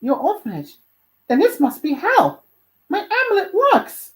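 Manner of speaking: high-voiced, growing amazement; surprised. Dante learning where he has landed, surprised and excited. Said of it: "Dante looked surprised and said".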